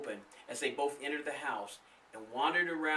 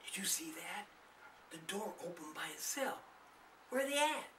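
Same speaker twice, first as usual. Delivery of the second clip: softer frightened whisper; 5 semitones higher, 8 dB quieter than usual. A narrator's reading. Darlene whispering to Charlie at the door, scared. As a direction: hush it to Charlie in fear. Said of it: "Darlene whispered to Charlie".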